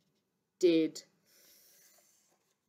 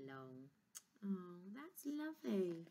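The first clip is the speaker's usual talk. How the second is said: plain reading voice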